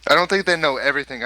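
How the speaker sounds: normal talking voice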